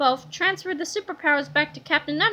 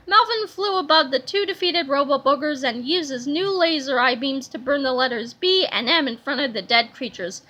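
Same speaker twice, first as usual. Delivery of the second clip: plain reading voice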